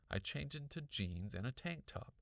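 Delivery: usual speaking voice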